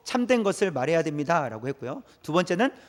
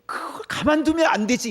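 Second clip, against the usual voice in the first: higher, mock-indignant protest; acted indignation; 8 semitones higher than usual. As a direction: egg someone on with raised pitch. Acts out the urge of anger. (분개한 척 높인 목소리)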